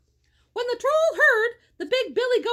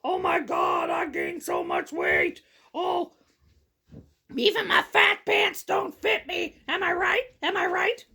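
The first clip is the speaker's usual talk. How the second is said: hammed-up coworker shocked at weight gain; mock coworker; 3 semitones lower than usual. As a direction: pretend to be a coworker after quarantine. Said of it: "we're all gonna be like".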